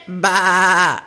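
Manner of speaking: low, quavering sheep baa; sheep's bleat. A narrator's reading. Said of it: "Sheep said".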